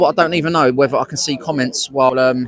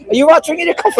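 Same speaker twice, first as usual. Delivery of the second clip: mock question; 3 dB louder, faster than usual. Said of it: "I was like".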